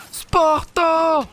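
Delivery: high, loud shouted name; yelled exclamation; sudden shout. Real, not acted. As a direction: call out at the screen. Shouting at enemy in game.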